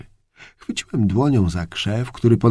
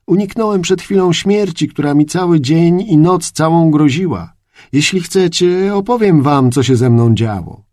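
lightly raised, eager traveller's voice; Sindbad voiced; 6 semitones higher, 5 dB louder than usual. A narrator's reading. Sindbad tells the grooms he escaped death, eager. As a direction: tell them earnestly, a bit higher and louder.

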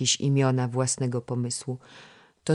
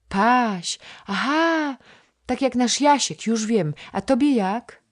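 put-on high, bright chatter of a small princess-girl, higher and louder; a chattering little girl coaxing the boy to play; little girl Krysia; 10 semitones higher, 6 dB louder than usual. (wysoki głosik małej dziewczynki)

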